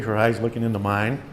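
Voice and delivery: usual speaking voice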